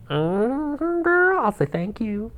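sassy diva voice. Pretend. Sassy high voice aside.

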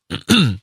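loud throat clear close to the mic. Real, not acted. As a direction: clear your throat right into the microphone. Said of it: "Excuse me for clearing my throat".

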